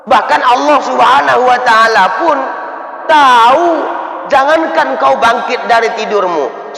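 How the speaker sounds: high, loud preaching voice as the angel; full-force sermon angel voice; angel's reply, preached. Retold or imitated. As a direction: proclaim it like a booming angel. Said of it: "Apa kata malaikat itu".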